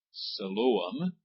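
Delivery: Siloam said as si-LO-am, stress on the middle syllable; three clear syllables in Siloam: long I, stressed long O, light am; careful teacher's demo of Siloam, each syllable marked. Shown as a demonstration. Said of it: "that middle syllable is the accented one".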